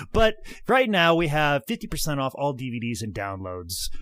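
ordinary talk, nothing marked

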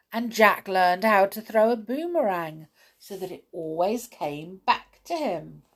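usual speaking voice

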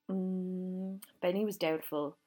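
usual speaking voice

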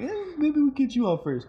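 high, playful put-on voice; squeaky teasing tone; high mock voice. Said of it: "I'm like".

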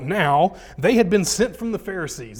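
loud, declaiming Bible reading; scripture reading. Acted. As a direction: proclaim the verses to the room. Reads a verse aloud.

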